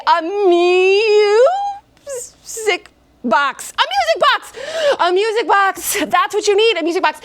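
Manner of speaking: high, rushed music box repeats; covering a blunder in a hurry; flustered cover-up. Acted.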